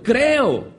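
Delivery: high-pitched cry of belief, mildly acted. Father of the boy answers Jesus, crying out. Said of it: "llorando le dijo".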